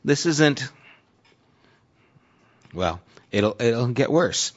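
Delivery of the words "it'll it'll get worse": ordinary talk, nothing marked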